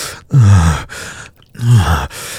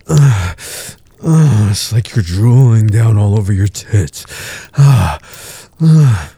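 moaning; 3 semitones higher than usual. Acted. Aroused moaning in the scene.

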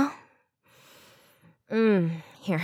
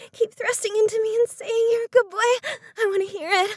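fast and much higher, breathless urging; urgent pleading; 9 semitones higher, faster than usual. Acted. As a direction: rush it out, pitched up. Urging partner near climax.

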